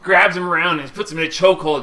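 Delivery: usual speaking voice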